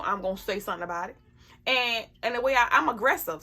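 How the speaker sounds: normal talking voice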